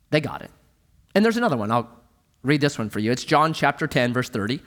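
ordinary talk

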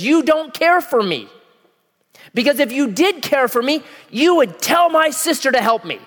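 high, loud accusing whine; mad Martha. Acted. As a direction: accuse Jesus like a hurt sister. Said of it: "She's mad at Jesus".

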